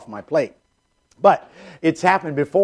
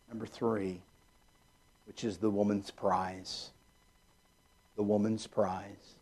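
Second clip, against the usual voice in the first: soft emphatic point; 6 semitones lower, 15 dB quieter than usual. Real, not acted. Announcing point softly.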